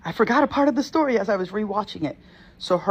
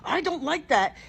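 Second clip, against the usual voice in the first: alarmed; 8 semitones higher than usual. Retold or imitated. Retold alarm at demon energy.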